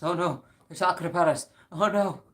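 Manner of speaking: loud, high mock panic; hammed-up oh no; mock alarm. Mock alarm at Kiwi Farms.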